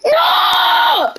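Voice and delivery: very high, long "no"; dismayed wail. Real, not acted. Player crying out at the game, dismayed wail. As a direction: hold a high wail of dismay before it drops.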